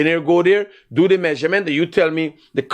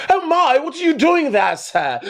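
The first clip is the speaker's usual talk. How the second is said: high, sweetly polite mock; fake-gentle, pitched way up, then laugh; mock politeness; 9 semitones higher than usual. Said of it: "I say".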